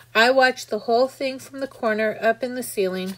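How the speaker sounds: ordinary delivery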